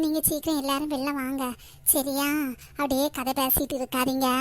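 ordinary talk

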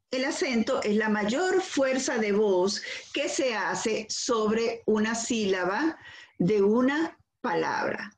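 usual speaking voice

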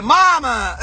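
high, loud, wailed mock protest; exaggerated whine. Child protesting to mother, hammed-up whine. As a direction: cry it out, hammed-up and girlish. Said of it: "Well, not like that".

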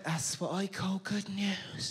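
mock old woman, slow and low; a sixty-year-old jogger leering at a young man; granny voice. Hammed-up granny leer. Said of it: "honestly went".

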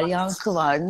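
ordinary talk, nothing marked

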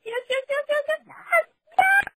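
high, excited squealing. Retold or imitated. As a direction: squeal it with glee. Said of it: "I was like".

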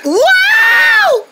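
very high, loud wow; excited whoop. Acted. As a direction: whoop as you fly off. Bounces into warp pipe.

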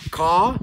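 "K" said as "Ka", with a vowel attached. Shown as a demonstration. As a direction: attach the A to "K" so only the vowel is heard. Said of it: "so wie du an den K ein A dranhängst". ("K" mit angehängtem A gesprochen)

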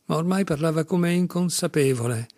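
plain reading voice

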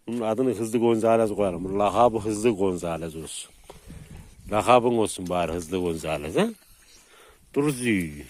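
ordinary talk

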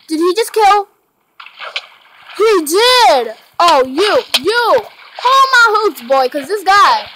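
loud high mock outrage; indignant outburst. Real, not acted. Gamer scolding player who killed a teammate, loud mock outrage. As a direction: get worked up at him.

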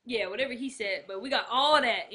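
usual speaking voice

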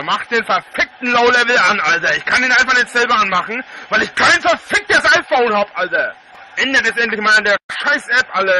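loud furious ranting; berserk rage. Real, not acted. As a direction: yell it in real fury. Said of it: "ich heute echt wieder stocksauer bin".

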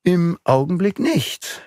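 musing, pitch rising then settling; thoughtful. A narrator's reading. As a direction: think it through aloud, pausing between phrases. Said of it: "sagte Beautrelet nachdenklich".

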